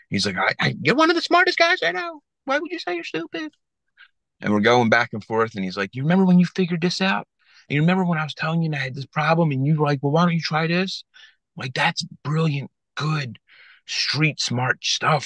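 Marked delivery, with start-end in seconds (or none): 0.4-3.5 high scolding voice. Retold or imitated. Boss unloading on Frank. 5.9-15.2 excited high boss voice. Retold or imitated.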